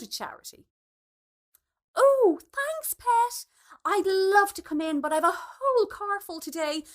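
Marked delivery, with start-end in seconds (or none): 1.9-6.8 high, loud cheery goodbye; bright brush-off. A narrator's reading. Alice gushing an excuse to Sue, bright and loud. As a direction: gush thanks while backing away.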